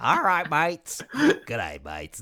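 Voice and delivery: mock gruff Australian greeting; hammed-up Australian mateyness; Australian accent. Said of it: "give him an Australian accent".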